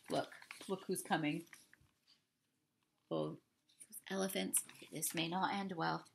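soft, fast murmured questions; hushed asides. Real, not acted. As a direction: lean in and ask quietly. Quiet asides.

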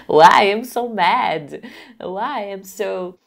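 slow, pained question; mock hurt. Shown as a demonstration. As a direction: ask it slowly, as if hurt. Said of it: "I would say".

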